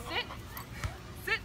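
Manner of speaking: breathless commands after running; winded, catching breath. Real, not acted. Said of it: "I'm out of breath now".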